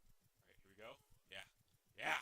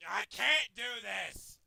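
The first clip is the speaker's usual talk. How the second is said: loud, slow "can't"; drawn-out frustrated complaint; exasperated cry; 5 dB louder, slower than usual. Real, not acted. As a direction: wail it out slowly, giving up. Giving up loudly.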